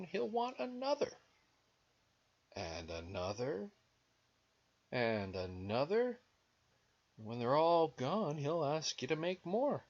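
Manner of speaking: put-on animated children's story reading; picture-book narration. A narrator's reading. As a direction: read to small children, pitch climbing at phrase ends.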